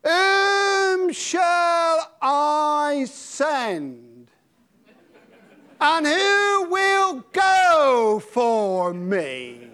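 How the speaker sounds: slow, high, loud calling voice; God calling out, drawn out and loud; booming voice of God. Shown as a demonstration. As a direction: call out across the room as God. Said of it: "And I start to talk like this".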